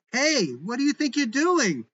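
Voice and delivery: high, loud outraged yell; woman thrown against the wall yells at the agent. A narrator's reading. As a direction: shout at him as he throws you. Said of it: "Elena, yelling".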